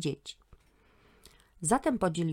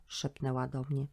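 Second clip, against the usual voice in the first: usual speaking voice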